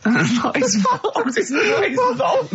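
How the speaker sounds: giggling through the words, high pitched; laughing. Real, not acted. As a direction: laugh it out helplessly.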